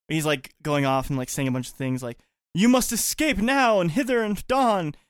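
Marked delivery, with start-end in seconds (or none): 2.5-4.9 mock biblical prophet. Villain quote in archaic style.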